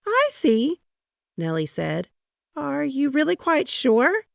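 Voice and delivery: high-voiced fussing wife; worried wife. A narrator's reading. First Lady checking on her husband in the bath. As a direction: ask sweetly, faintly suspicious.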